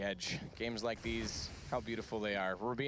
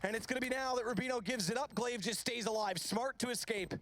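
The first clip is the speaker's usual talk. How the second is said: high, fast, excited play-by-play; esports caster; 11 semitones higher than usual. Acted. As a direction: build the tension of a clutch round. Clutch round play-by-play rising.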